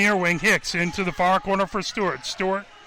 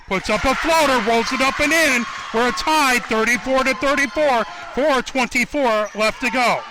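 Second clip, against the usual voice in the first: excited call; 5 semitones higher than usual. Real, not acted. Commentator calling the tying basket, excited. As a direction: lift the voice as the score ties.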